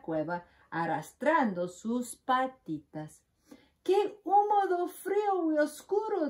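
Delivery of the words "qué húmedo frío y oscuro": high squeaky mouse voice; a tiny mouse shivering in the cave; little mouse voice. A narrator's reading. Mouse entering the cold cave, high squeaky voice. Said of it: "dice Ratón".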